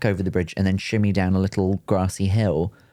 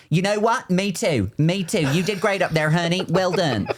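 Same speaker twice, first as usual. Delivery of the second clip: much higher, gushing praise from the crowd; gushing stage mom; 9 semitones higher than usual. Retold or imitated. Host imitating a mum praising her toddler from the crowd. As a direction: clap and gush like a proud mum. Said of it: "her mum".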